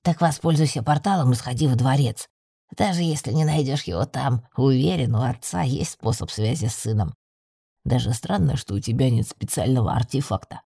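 low male-sounding familiar voice; deep-voiced little magical companion.

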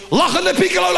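mock shout to blackmail the brother; shouted threat. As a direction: yell it out to wake the house. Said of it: "ga ik keihard roepen".

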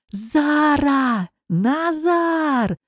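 long, high-pitched calling; high, stretched search call; hoarse yell. A narrator's reading. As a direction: holler names with drawn-out vowels. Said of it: "хрипло завопил Славик".

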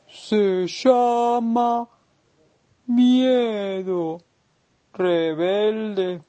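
voz de tortuga lentísima, con vocales alargadas; tortuga pausada. Acted. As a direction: responde muy despacio, arrastrando cada palabra como una tortuga. La tortuga explica al caracol. (tortuga muy lenta y pausada)

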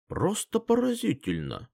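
high, rising amazement; excited exclamation. A narrator's reading. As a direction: burst out in delight. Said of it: "бормотал он".

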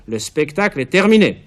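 loud, solemn announcement. A narrator's reading. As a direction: proclaim it like a herald. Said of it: "un garde dit d'une voix solennelle".

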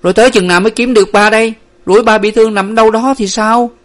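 loud, high, quick protest; distressed, loud demand. A narrator's reading. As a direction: argue heatedly, worried for your father. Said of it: "Nguyệt to tiếng".

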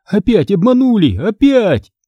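high-pitched narrated outburst; indignant cry. A narrator's reading. Reader cheated by a book again, indignant. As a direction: exclaim it in exasperation. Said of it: "закричал я".

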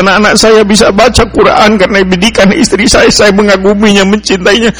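high, pleading voice on the edge of tears; tearful complaint. Retold or imitated. Engineer weeping to the preacher about his wife, tearful and high. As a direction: retell the engineer's sobbing lament. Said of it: "Dia datang ke rumah menangis".

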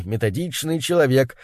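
ordinary delivery

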